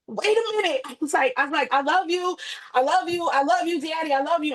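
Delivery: cling to him with every word; frantic pleading. Retold or imitated. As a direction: daughter cries out love to her dying father, frantic. Crying out to dying father.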